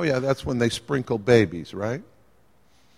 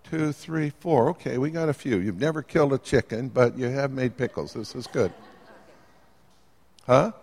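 usual speaking voice

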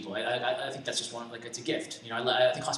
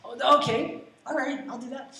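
much higher, put-on agreeable voice; mock compliance; 10 semitones higher than usual. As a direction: act out giving in to a favor. Acted reluctant yes to coworker.

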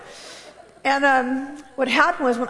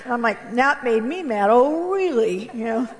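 ordinary talk

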